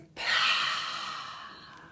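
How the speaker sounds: long voiceless exhale; releasing sigh. Shown as a demonstration. Meditation leader releasing tension for listeners, breathy release sigh. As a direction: let the held breath whoosh out.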